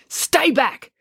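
high, threatening order. A narrator's reading. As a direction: warn him off firmly. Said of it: "threatened him with it".

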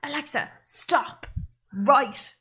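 imitated shouting. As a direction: imitate scolding a device word by word. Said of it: "him shouting at the device".

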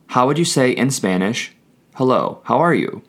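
normal talking voice

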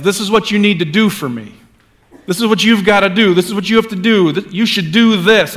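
a bossy imagined God giving orders; lightly mimicked stern inner voice; demanding voice of God. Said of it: "this voice of God in our heads".

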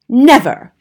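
loud, sharp outraged cry; indignant cry. A narrator's reading. Manager protests the grandmother's rat claims, loud outraged cry. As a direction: fling the word out in protest. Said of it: "cried Mister Stringer".

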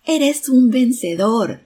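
loud, high, drawn-out call; shout to the wind. A narrator's reading. Father shouts to the wind for his son, loud slow call. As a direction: shout it out slowly into the distance. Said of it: "Y le gritó al viento".

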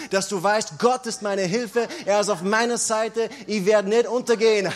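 rising, loud, fervent sermon voice; preaching at full force. Acted. Pastor rallying the congregation against the devil, preaching at full force. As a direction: build it up, pounding each line like a victory cry.

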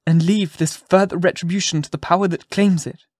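earnest plea. A narrator's reading. Hester pleads for forgiveness.

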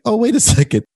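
sudden realization, pitched well up. Retold or imitated. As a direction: catch yourself mid-complaint. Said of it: "I was like".

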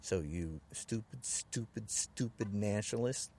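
low, soft-spoken menace; pissed off, restrained. Real, not acted. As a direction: warn them with quiet, controlled anger.

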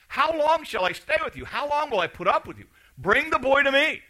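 high, exasperated lament; a hint of weary frustration, high; frustrated Jesus. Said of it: "he's somewhat frustrated".

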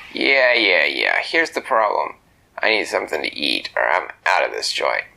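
low, pushy tough-guy bird; gruff flamingo voice. Acted. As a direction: growl it like a grumpy little bird. Flamingo Rupert in story.

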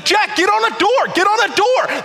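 high urgent call to Jack; mock yelling. Pastor shouting at Jack on the screen, high and loud. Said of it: "I am just yelling at everyone".

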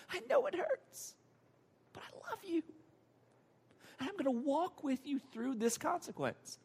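put-on soft, high, tender voice to a hurting child; tender comforting. Loving dad embracing his crying child, softly tender. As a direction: soothe gently, high and hushed.

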